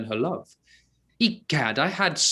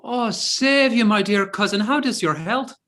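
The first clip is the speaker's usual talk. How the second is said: Irish brogue; 6 semitones higher than usual. Acted. Archer greeting the priest as his cousin, in a hearty Irish brogue. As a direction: greet him as a long-lost Irish cousin. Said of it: "His brogue will hang mine".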